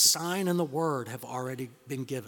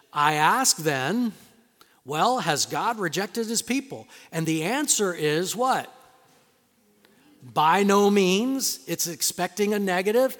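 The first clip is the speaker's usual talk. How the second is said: loud, driving pulpit delivery; hammering home salvation by grace; full-force preaching; 2 semitones higher, 8 dB louder than usual. Acted.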